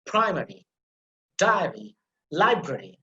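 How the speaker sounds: "primary", "diary", "library", each with a strong first syllable; stress on first syllable. Shown as a demonstration. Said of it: "put emphasis on the first syllable".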